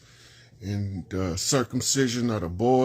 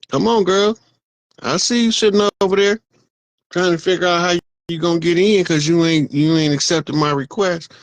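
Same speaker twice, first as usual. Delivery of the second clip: loud, higher call to a friend; 4 semitones higher, 6 dB louder than usual. Real, not acted. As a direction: call her in, voice raised. Calling out to a viewer.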